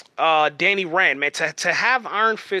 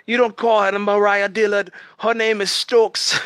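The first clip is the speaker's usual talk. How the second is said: hammed-up Jamaican accent, higher and louder; a bad Jamaican-accented villain, insistent; Bushmaster accent; 7 semitones higher, 5 dB louder than usual. Fan quoting the villain correcting Mariah's name in a hammed-up Jamaican accent. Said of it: "Bushmaster would always say".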